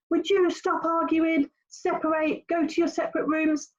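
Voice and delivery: put-on weary mum ordering squabbling kids apart; nagging parent. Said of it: "they're so used to you going".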